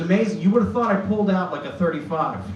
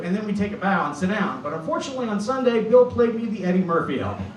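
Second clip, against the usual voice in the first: normal talking voice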